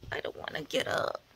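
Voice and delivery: whispered morning groan; hushed grumble from bed. Real, not acted. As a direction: whisper it, still half asleep.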